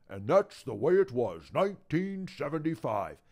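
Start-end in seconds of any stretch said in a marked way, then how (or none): none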